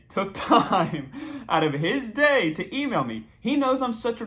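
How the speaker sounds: laughing through the words in disbelief; drawn-out words broken by laughter. Real, not acted. Delighted reveal of celebrity name.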